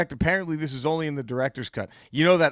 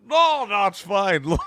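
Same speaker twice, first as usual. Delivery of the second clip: Sean Connery; 3 semitones higher, slower than usual. Acted. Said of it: "He's just Gollum".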